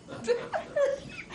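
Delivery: helpless high-pitched laughter; high wheezing laugh. Real, not acted. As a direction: laugh until the voice squeaks. Bursts out laughing.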